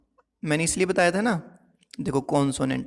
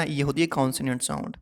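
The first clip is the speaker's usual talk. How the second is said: normal talking voice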